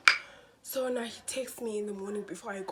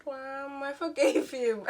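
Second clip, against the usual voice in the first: slow laughing; 5 semitones higher, 5 dB louder, slower than usual. Real, not acted.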